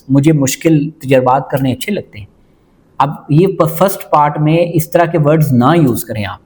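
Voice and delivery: normal talking voice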